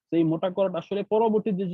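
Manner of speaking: usual speaking voice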